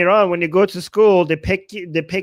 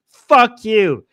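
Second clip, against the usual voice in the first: loud, falling outburst; angry retort; 3 semitones higher, 6 dB louder than usual. Real, not acted. Streamer answering critics of the accent, real angry retort. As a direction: spit the curse back at them loudly.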